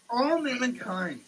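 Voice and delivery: normal talking voice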